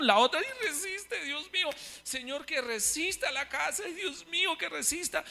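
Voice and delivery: high, hushed, desperate urging; frightened pleading. Retold or imitated. Brother reliving the earthquake, begging his house to stand, high hushed plea. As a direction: beg the house to hold, pitched high and soft. Brother praying during the quake.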